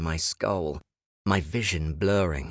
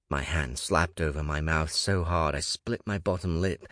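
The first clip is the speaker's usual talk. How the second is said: usual speaking voice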